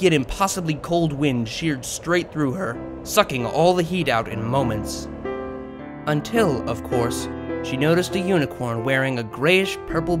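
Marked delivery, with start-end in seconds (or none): none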